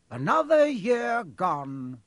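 high, drawn-out, cheery proclamation; cheerful. A narrator's reading. Dumbledore greets the feast, cheerful. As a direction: open the speech loudly and cheerfully. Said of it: "Dumbledore said cheerfully".

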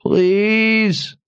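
high, muffled plea from under a rock; muffled pleading voice. A narrator's reading. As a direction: beg weakly, trapped. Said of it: "came a muffled voice from under the rock".

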